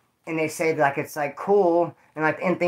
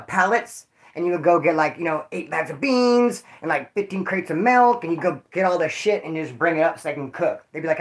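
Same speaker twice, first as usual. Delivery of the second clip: normal talking voice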